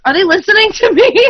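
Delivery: mock indignant disbelief. Retelling yelling at the TV, high mock outrage. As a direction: squeal it at your husband in mock outrage. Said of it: "I'm like".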